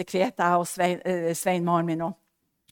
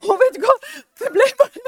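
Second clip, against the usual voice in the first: normal talking voice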